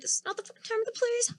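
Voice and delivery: retold hushed, high-pitched objection; hushed reproach. Hissing at client beside another regular.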